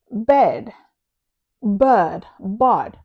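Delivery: bed, bird, bod said one by one, each vowel kept distinct; distinct pronunciation. Shown as a demonstration. Teacher showing learners three different words, distinct pronunciation of "Bed, bird, bod". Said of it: "they're all different words that need to be pronounced differently".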